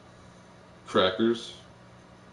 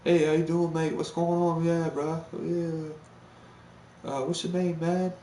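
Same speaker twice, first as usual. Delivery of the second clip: amped-up British guy; 8 semitones higher than usual. Retold or imitated. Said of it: "versus battle on Meet Me".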